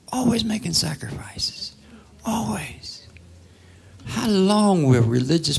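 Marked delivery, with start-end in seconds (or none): 0.1-3.1 hushed mocking whisper. Preacher whispers mockingly to the congregation. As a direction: breathe it out as a sneer at the habit. Mocking religious people's habit.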